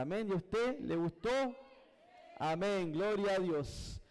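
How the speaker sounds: normal talking voice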